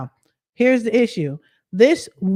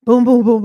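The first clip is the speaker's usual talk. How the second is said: door banging; 5 semitones higher, 9 dB louder than usual. Retold or imitated. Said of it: "banging on my door like they're the cops".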